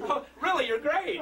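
high, put-on flattery; rehearsed gushing. Magician flattering the audience with a rehearsed line, mock gushing. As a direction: gush as if practiced in the mirror. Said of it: "I practiced that in the mirror".

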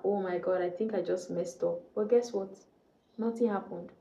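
ordinary talk

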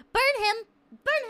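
mock-condemn him to the stake; mock outrage. Streamer mock-condemning a chatter for the soda joke, hammed-up yelling.

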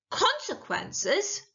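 high, drawn-out incredulous question; scornful witch. A narrator's reading. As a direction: stretch the word out in scorn.

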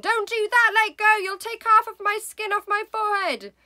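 high, loud panicked pleading; screaming. Acted. Husband begging wife to stop pulling, high loud scream. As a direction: scream to make her let go. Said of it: "he screamed".

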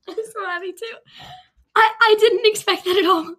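high, loud happy disbelief; overwhelmed with happiness; joyful near tears. Real, not acted. Hugging crying mother.

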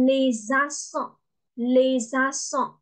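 "les accents" joined with a buzzing Z; liaison with Z. Shown as a demonstration. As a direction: link "les accents" as lay-zak-son, carefully. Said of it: "Z အသံန ... ဲ့အသံဆက်ပါတယ်".

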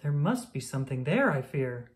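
usual speaking voice

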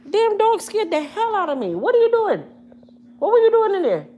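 loud high scolding; scolding the dog. Real, not acted. Owner scolding her howling dog, loud high exasperated shouting.

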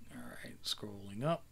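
unvoiced aside under the breath; whisper. Real, not acted. Reader whispering an aside while scrolling the text. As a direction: whisper it to yourself, off the page.

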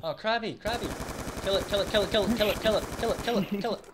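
high, loud frantic urging; excited yelling. Real, not acted. Gamer screaming at teammate to kill the crab, frantic excited yell.

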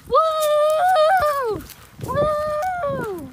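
high, drawn-out "woo"; long gleeful play whoop; playful whoops. Pretend. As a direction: whoop loudly, letting the pitch fall.

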